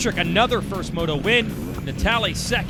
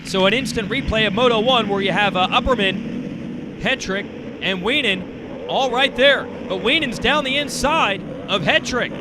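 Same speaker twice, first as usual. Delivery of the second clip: excited live race call; motorsport commentator; 3 semitones higher than usual. Acted. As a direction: call the battle for the lead.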